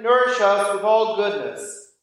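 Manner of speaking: usual speaking voice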